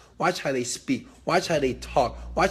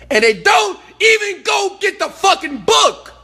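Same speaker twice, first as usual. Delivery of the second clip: loud, high-pitched outburst of frustration; exasperated shout; 7 dB louder than usual. Real, not acted. Speaker fed up with those who ask, exasperated shout. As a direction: explode at people who never follow through.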